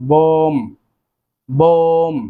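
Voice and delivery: বোম said slowly and clearly, with the b silent; careful pronunciation. Shown as a demonstration. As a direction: say বোম as a model word, slow and clean. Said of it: "উচ্চারণ করতে হবে".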